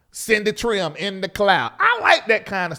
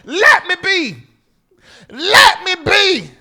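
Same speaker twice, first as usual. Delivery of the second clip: high, loud plea; exasperated shout; 10 semitones higher, 8 dB louder, slower than usual. Real, not acted. Host fed up with donation callers, hollering. Mock complaint at constant donation calls.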